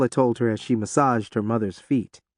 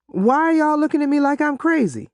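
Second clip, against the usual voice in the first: put-on high, bright young girl; teen girl voice; 13 semitones higher than usual. A narrator's reading. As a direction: play the clueless teenage daughter.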